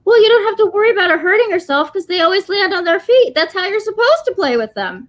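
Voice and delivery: high, matter-of-fact dog voice; earnest dog. Retold or imitated. Dog reassures that cats always land safely, high. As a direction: explain it like the obvious truth. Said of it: "and that he said".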